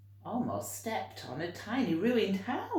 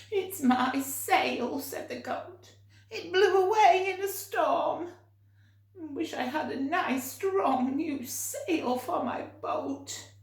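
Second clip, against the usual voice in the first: bleating goat; 10 semitones higher, 6 dB louder than usual. Acted. Goat on the boat bleating to George about its lost sail. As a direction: wail it like an upset goat. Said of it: "bleating loudly".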